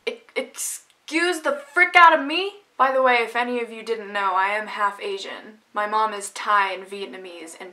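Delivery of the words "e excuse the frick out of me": high, loud outraged exclamation; indignant disbelief. Real, not acted. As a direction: gasp out the words like you can't believe it. Reacting to racist remark.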